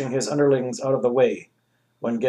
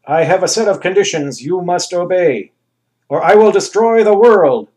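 louder, higher booming threat building up; thundering threat; 5 semitones higher, 8 dB louder than usual. Acted. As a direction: thunder the ultimatum. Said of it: "the Anus thundered".

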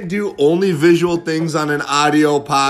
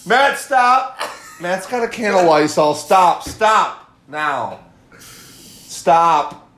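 frantic shouting; 7 semitones higher, much slower than usual. Real, not acted. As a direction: yell at him to stop. Friend spraying Lysol at him.

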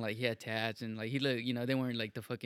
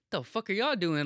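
higher, louder indignant challenge; heated retold protest; 7 semitones higher, 6 dB louder than usual. Retelling to a friend how he challenged his crew, indignant and loud. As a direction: replay an outraged demand at your friends.

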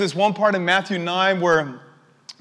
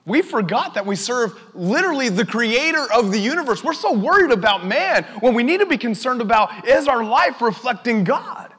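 raised, urgent sermon voice; impassioned preaching; 6 semitones higher, 4 dB louder than usual. Real, not acted. Preacher urging the church to reflect God, impassioned. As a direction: press it on the congregation, pitched higher.

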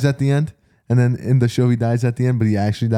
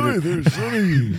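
put-on slow, higher old man voice; 5 semitones higher, slower than usual. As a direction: play a doddering old man. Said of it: "old man voice".

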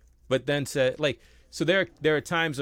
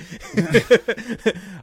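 loud, higher burst of laughter; real laugh; 8 semitones higher, 8 dB louder than usual. Real, not acted. Host amused by his own joke, laughing hard. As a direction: crack up at your own joke.

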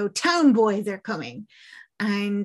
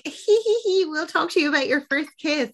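high, quick mock-girlish titter; giggly teasing; 7 semitones higher, faster than usual. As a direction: tease with a put-on giggle. Imitates girls giggling, teasing a friend.

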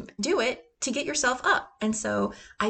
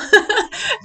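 high loud laugh bursting out; a sudden high laugh; burst of laughter; 10 dB louder than usual. Real, not acted. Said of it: "laughs at normal".

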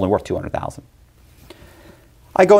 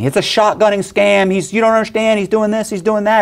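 mock scream; 10 semitones higher than usual. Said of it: "the guy's screaming".

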